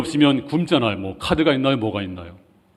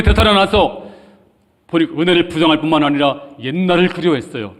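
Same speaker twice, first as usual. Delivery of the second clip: higher, louder impassioned sermon; full-force preaching; 6 semitones higher, 6 dB louder than usual. Acted. Preacher rebuking God's people for their ingratitude, fervent and loud. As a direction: drive it home from the pulpit.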